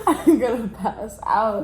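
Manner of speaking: slow, laughing through pain. Real, not acted. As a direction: giggle it out, overwhelmed. Laughing through burning mouth.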